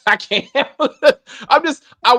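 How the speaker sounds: breaking up with laughter; laughing too hard to finish. Real, not acted. Host cracking up at her cohost.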